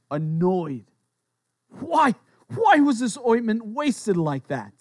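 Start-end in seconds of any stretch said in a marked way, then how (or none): 1.7-4.7 slow, higher-pitched annoyance; drawn-out, indignant complaint. Acted. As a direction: read it as an upset objection. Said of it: "said to themselves indignantly, angry, upset, annoyed".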